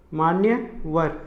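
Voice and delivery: मान्यवर spoken very slowly, the य in "न्य" sounded clearly; slow correct pronunciation. Shown as a demonstration. Teacher to students, drawn-out correct pronunciation. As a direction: stretch out मान्यवर to show the right way. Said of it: "मानवर का क्या होगा".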